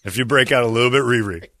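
ordinary delivery

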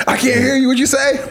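high-pitched mock holler back; exaggerated high yell from another room; screaming through the house. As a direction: call out as if you can't hear. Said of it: "You gotta scream through the house".

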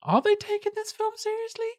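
high squeaky mock disbelief; cartoonish disbelief; incredulous. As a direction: sound baffled, pitch jumping way up. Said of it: "and you're like".

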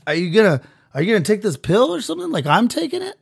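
high, squealing defensive mooch; whiny freeloader. Acted. A freeloader answering his nagging partner, in a high whine. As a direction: whine back high like a spoiled kid.

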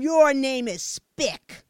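slow, loud sneer; cold taunt. A narrator's reading. Fat boy rejecting Michelle's friendliness, slow loud sneer. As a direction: drag it out with contempt.

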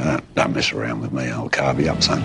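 low, quiet hint of menace; lightly voiced tough guy warning; understated threat to a mugger; mild mock threat. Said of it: "the suggestion that".

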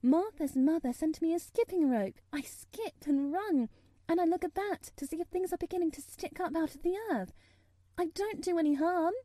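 put-on high, breathlessly earnest child; anxious little girl. A narrator's reading. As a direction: plead your innocence in a girl's high voice. Narrator plays anxious Mary.

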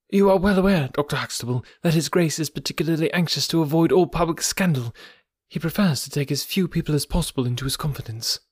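lightly voiced secretary, firm and incisive; incisive, positive tone. A narrator's reading. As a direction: press the warning firmly but politely. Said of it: "in an incisive and positive tone".

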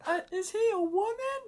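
put-on very high, childlike question; puzzled kid. Son asks daddy about the large man, very high kid voice. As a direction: squeak it out like a confused little boy.

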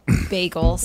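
"bagels" said with the first vowel toward "bag"; short flattened first vowel on "bagels"; unusual vowel in bagels. Shown as a demonstration. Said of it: "criticized for how I say".

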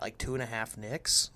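normal talking voice

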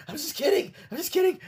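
high-pitched, hurried-sounding retraction; mock nervous backpedal. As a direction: imitate someone anxiously calling off a prank. Acted backing down from prank.

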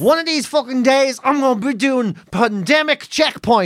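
slow, weighty declaration; imitating a would-be hero, drawn out; mock dreamy ambition. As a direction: spell out the absurd dream slowly. Mocking a cadet's supposed dream.

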